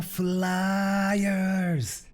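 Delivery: high, loud hype call; loud greeting. Real, not acted. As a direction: belt out the opening hello. Streamer's signature loud greeting.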